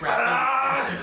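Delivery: harsh high cracking noise; ugly cracking sound. Shown as a demonstration. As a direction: voice the crack of splitting bone. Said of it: "a really ugly, like, cracking".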